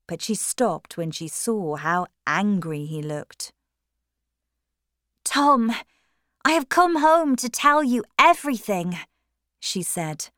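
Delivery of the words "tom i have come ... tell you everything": put-on high, louder young woman; hopeful sister. A narrator's reading. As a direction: reach out to an angry brother. Maggie faces angry brother.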